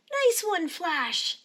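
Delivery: high, loud cheer for the dog; shout. A narrator's reading. Lionel shouts praise at his dog Flash. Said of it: "shouts Lionel".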